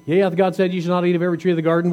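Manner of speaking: rushed, fast reading of the verse; quick read-through. Shown as a demonstration. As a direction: rattle off "Yea, hath God said" quickly. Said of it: "What question did he ask?".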